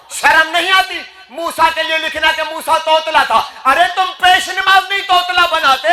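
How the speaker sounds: high, forceful sermon rebuke; impassioned, high-pitched preacher's scolding; indignant preaching. Real, not acted. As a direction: cry out in outrage to the congregation. Preacher in full cry, indignant rebuke.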